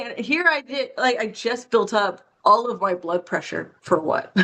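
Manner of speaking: ordinary talk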